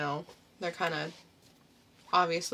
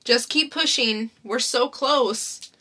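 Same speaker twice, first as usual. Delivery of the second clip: louder urging coach; [name] encouraging; 5 semitones higher, 9 dB louder, slower than usual. Acted. [name] urges Alana to push, loud and urging. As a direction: urge her on through the birth. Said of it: "[name] doing the characters".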